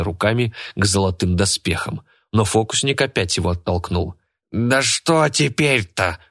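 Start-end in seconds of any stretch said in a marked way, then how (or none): 4.5-6.2 put-on slow, irritated old man outburst; displeased exclamation. A narrator's reading. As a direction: drag it out, fed up and annoyed. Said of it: "недовольно воскликнул Кайратов".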